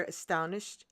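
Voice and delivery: plain reading voice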